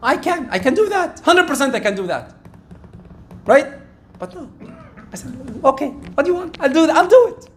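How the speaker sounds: ordinary talk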